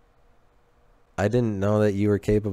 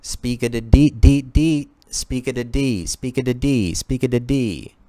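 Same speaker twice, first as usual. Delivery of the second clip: higher, rhythmic put-on voice; Speakeasy Tom; 4 semitones higher, 3 dB louder than usual. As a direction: chant nonsense in short repeated bursts. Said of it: "Speakeasy Tom?".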